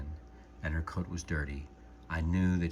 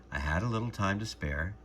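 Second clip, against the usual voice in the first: ordinary delivery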